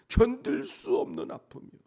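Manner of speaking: loud, high, impassioned cry; anguished outcry. Real, not acted. Preacher laments mockery of his beloved God, anguished outcry. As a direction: cry out the pain at full voice. Preacher voices unbearable pain.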